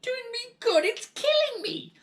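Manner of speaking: very high, loud outraged scream. A narrator's reading. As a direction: shriek the word back. Grandmother protesting in pain.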